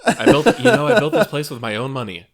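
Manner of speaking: laughing mock Seagal quote; high you know, half-laughing; quoting the tough guy through laughter; imitating Seagal. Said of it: "He just says it completely straight".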